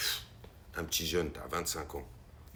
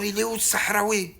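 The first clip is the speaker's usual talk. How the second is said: loud, higher shouted demand; officer shouting; 8 semitones higher, 7 dB louder than usual. Retold or imitated. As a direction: bark it across the yard. Police officer shouting for him.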